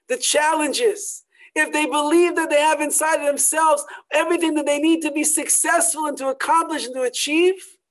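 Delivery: preacher driving the lesson home with fervor; rousing sermon. Acted. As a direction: build the congregation up with conviction.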